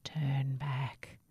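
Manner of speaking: low, eerie whisper; whispering wind. Acted. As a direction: warn softly like the wind. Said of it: "the wind whispered".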